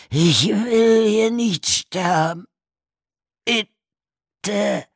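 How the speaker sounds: very high, loud, slow wail; pleading for his life; terrified begging. A narrator's reading. Buried man begs not to die, wailing plea. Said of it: "Walters raue, verzweifelte Stimme".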